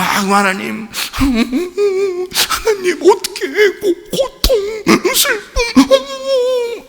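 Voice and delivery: high, very slow fake sob; hammed-up weepy wail; mock crying prayer. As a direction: ham up a whimpering plea to God. Mocking a whining prayer.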